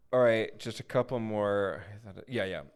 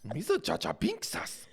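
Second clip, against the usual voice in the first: goofy put-on alien voice, pitched up; Jar Jar Binks; 4 semitones higher than usual. Host unlocking a new impression, goofy creature voice. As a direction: do a silly high cartoon creature. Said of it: "a new impression unlocked".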